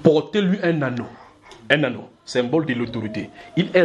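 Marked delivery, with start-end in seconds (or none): none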